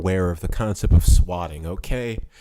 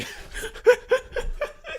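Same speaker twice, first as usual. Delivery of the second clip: shrieking laugh, caught out; a real squeal of laughter; high squealing laugh; 4 dB quieter than usual. Real, not acted. High laugh after reveal.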